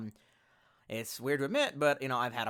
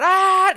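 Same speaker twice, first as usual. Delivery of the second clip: sudden loud high scream of pain; mock agonized scream; 19 dB louder than usual. Streamer retelling alcohol hitting the open blister, acted scream. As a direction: burst into a full acted scream, cutting off the calm.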